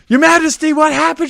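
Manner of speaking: high, loud urgent agent voice; a bodyguard rushing to the queen; concerned agent. Retold or imitated. Secret Service agent finding the queen, urgent high call.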